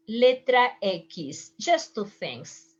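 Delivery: usual speaking voice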